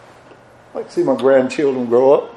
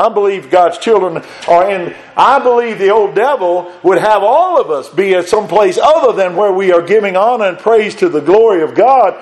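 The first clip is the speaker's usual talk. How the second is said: rising louder preacher's call; preaching building up; 5 semitones higher, 3 dB louder than usual. Acted. Preacher warning the congregation of the devil, building. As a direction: build the call toward the glory.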